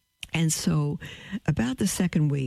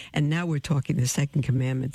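ordinary talk, nothing marked

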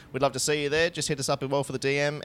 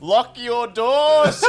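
high, loud and very slow mock alarm; laughing through it; megaphone warning; 13 semitones higher, 11 dB louder, much slower than usual. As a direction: drag it out like a street warning. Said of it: "we heard it through a megaphone".

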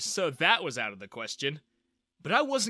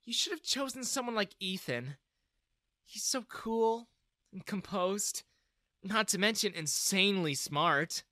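put-on dreamy girl; 6 semitones higher than usual. Daughter gushes about Ethan.

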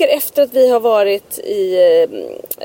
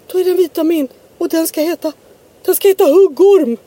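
imitating little boy; 5 semitones higher than usual. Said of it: "Och Pelle bara".

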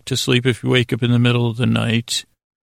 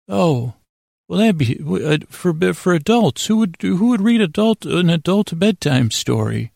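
a hint of a higher, puzzled official voice; lightly voiced receptionist; 6 semitones higher than usual. Institute clerk answering the doctor's odd request, lightly pitched up.

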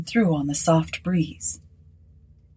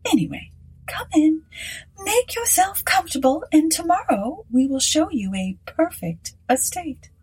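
much higher gracious hostess; Mrs Sinclair; 10 semitones higher than usual. Acted. Hostess inviting Aylett inside, high and gracious. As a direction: invite him in grandly.

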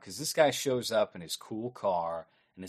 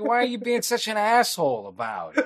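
much higher, louder mock whine; indignant complaint; 12 semitones higher, 7 dB louder than usual. Retelling the killer scolding the grieving father, high loud mock whine. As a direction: act wronged and offended. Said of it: "he's like".

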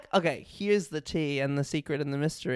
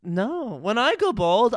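ordinary talk, nothing marked

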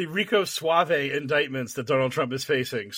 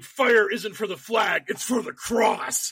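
slow, higher-pitched proclamation; mock slogan shout; 5 semitones higher, slower than usual. Imagined T-shirt slogan proclaimed.

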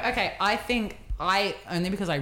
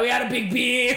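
put-on louder, higher proud drunken boast; proud drunk shout; 5 semitones higher, 5 dB louder than usual. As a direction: brag it tipsy and loud. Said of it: "We're like".

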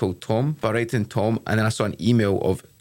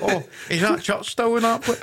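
pretend local asking; 13 semitones higher than usual. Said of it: "imitated local asking".

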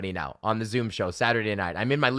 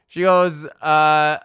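ordinary delivery

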